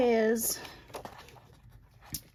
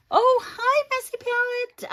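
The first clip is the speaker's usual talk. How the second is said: excited hi; 7 dB louder than usual. Real, not acted. Greeting a viewer in chat.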